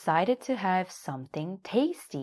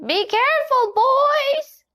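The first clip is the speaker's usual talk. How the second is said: much higher, louder hen call; shouting warning; 9 dB louder than usual. A narrator's reading. Hen shouts a warning at the playing boys. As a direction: call out from a window. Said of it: "shouted Nanny Hen from the window".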